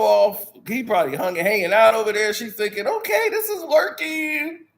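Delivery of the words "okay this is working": mock hopeful thought.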